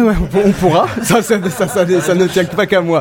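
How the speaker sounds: laughing while talking. Real, not acted. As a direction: talk through a laugh. Host laughs at remark.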